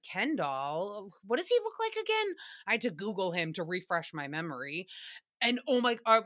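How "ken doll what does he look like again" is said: confused surprise. Retold or imitated. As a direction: blurt it out, baffled. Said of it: "I was like".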